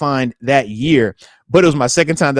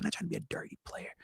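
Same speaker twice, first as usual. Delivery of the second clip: whisper; 4 semitones higher, 23 dB quieter, faster than usual. Retold or imitated. Wife's plea trails to a whisper.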